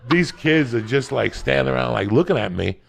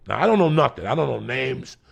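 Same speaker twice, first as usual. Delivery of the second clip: normal talking voice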